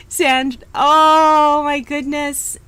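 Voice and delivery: drawn-out, loud, slow dismay. Real, not acted. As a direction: stretch it out in sympathy. Reacting to guest's sad story.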